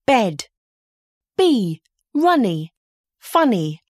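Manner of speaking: ordinary talk, nothing marked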